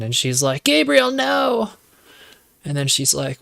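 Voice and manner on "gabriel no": much higher and louder, slow, desperate plea; hammed-up cry of no to the killer; mock pleading. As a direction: beg him to stop like a scared young woman. Madison begs the killer to stop.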